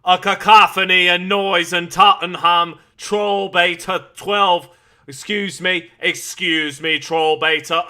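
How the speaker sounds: loud mock British announcer; performed on request. Streamer answers a viewer's request to say a phrase, loud announcer delivery. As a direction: belt it out like a ring announcer. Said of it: "Can you say a cacophony of noise?".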